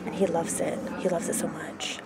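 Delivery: very soft, fading to a near whisper; quiet, breath-light murmur; hushed. Real, not acted. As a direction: confide it almost in a whisper. Confiding about God's love.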